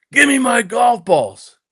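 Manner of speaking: high, slow, drawn-out order; imitated menacing demand. Golf tough confronting the young player over lost balls, menacing.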